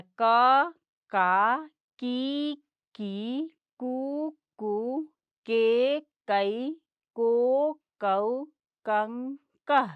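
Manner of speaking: each "क" syllable said slowly and loudly with its vowel; clear syllable drill. Shown as a demonstration. Teacher drilling the "क" series for children, loud and slow. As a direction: sound out "क, का, की, कु" one by one, loudly. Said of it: "ऐसे पढ़ना है।".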